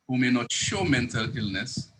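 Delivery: usual speaking voice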